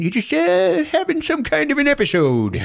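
high put-on soothing voice; hammed-up calming talk; mock soothing. John calming the boy after the attack, mock soothing.

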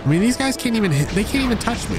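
high-pitched, hurried gloating; high, incredulous boast; cocky disbelief. Real, not acted. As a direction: scoff in a high voice at useless enemies. Gamer boasts as enemies miss him.

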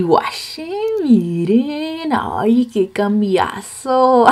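high, slowed amazement at the change; amazed reveal. Real, not acted. Vlogger showing viewers her makeover, amazed. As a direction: marvel at your own transformation.

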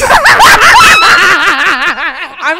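loud high burst of laughter; shrieking laugh. Real, not acted. Laughing at own bad accent attempt, helpless shriek. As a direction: explode into screaming laughter.

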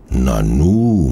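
a mildly puzzled, drawn-out exclamation; puzzled murmur. A narrator's reading. Detective watching a man climb into a flat, mildly puzzled. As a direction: let mild wonder stretch the word. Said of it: "murmelt Kugelblitz verwundert".

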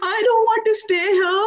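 high, tearful pleading, voice close to breaking; retold crying plea. To Swami in a dream, begging to go home, tearful. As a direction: beg through tears like a homesick student. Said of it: "And I started crying".

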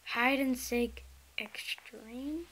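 slow hushed whisper; whispering while hiding. Real, not acted. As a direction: whisper it slowly so nobody hears. Reading game title while hiding.

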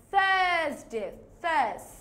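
tongue out for TH, long "thuhs" in থার্সডে; demonstrated pronunciation. Shown as a demonstration. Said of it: "So কি বললাম?".

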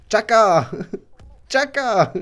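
high laughing battle cry; triumphant laugh. Real, not acted. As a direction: cackle and cheer in triumph. Triumphant cackle after hit.

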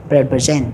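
pre-present with P and R joined onto the vowel; linked consonants. Shown as a demonstration. Teacher modelling the linked P-R in pre-present. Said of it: "P với chữ R chúng ta nối".

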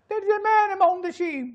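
high, slow mimicked cry; high film character voice. Shown as a demonstration. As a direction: call it out slowly, pitched far up. Film scene, two contrasting voices.

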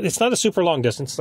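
ordinary talk, nothing marked

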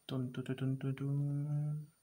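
whispered then slow drumroll; drumroll sounds. Shown as a demonstration. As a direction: build suspense with a mouth drumroll. Teacher builds suspense before face.

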